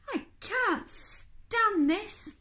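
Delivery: high, drawn-out complaint; exasperated Mister Bear. Acted. Sleepless bear complains about the snoring, drawn-out exasperation. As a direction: draw out each word, loudly fed up.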